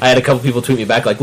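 usual speaking voice

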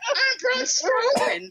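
very high, slow, squealing silly voice; Braun Strowman. Retold or imitated. Fan repeats the wrestler impression, drawn-out high squeal. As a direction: squeak it out far above your own pitch. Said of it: "he'll do the voice of Braun Strowman talking".

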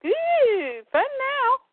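very high, gleeful creature squeal; gleeful monster. Acted. A Parademon delighted at the fight, very high and gleeful. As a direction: relish it like a toy. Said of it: "the other parademon goes".

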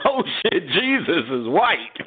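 high, slow mock gasp of surprise; shocked churchgoer, hammed up. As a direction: play a stunned believer. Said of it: "in the church going".

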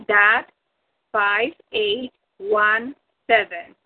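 slow, spaced repeat of the spelling; slow dictation. Real, not acted. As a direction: spell it out again, slowly, with pauses. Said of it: "and I'll repeat it".

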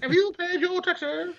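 squeaky toff question; mock British accent. Co-host joins in with a high mock British voice.

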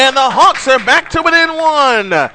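rising, roaring home run shout; home run call. Real, not acted. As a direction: build from "going" to a full yell. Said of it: "hope you like that home run call".

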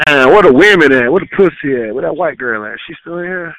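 loud call across the room; shouting out. Real, not acted. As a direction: bellow it out to the whole place.

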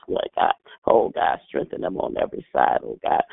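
ordinary talk, nothing marked

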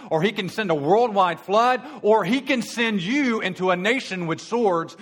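a preacher warning of brimstone; fiery preaching. Acted. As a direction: thunder out the judgment. Preaching at full force.

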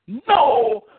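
a loud, high warning cry that drops on the name; imitated holler. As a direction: call out to stop him stepping. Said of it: "they in unison hollered".

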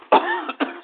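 harsh chesty cough; coughing fit. Real, not acted. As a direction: cough hard from pneumonia. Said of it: "start coughing when I talk".

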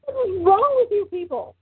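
high, disbelieving cry; incredulous. Retold or imitated. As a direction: react with exasperated disbelief. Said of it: "I'm like".

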